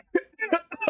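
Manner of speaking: harsh real coughing fit; choking cough. Real, not acted. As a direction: cough hard, water down the wrong pipe. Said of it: "oh god, I'm choking".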